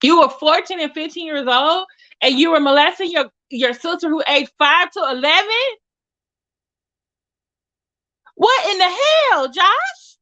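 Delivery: high-pitched, incredulous telling-off; rising in disbelief as you scold him; indignant scolding. Real, not acted. Indignantly scolding Josh as if present.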